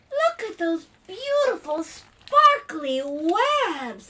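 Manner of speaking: high, loud, drawn-out wonder; amazed admiration. Acted. Family discovering sparkly webs, hammed-up amazement. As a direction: marvel aloud after a gasp.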